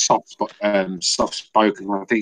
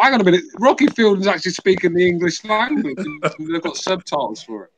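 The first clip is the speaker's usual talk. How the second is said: incredulous; 9 semitones higher than usual. Retold or imitated. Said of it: "I thought".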